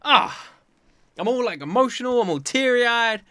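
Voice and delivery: loud and high, moved nearly to tears; emotional. Real, not acted. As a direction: gush like the episode got you. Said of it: "I'm so freaking emotional".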